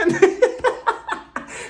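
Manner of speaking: high, breathy cackle; real laugh. Real, not acted. Host cracking up after a jab at Heather, real laughter. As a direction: laugh hard at your own joke.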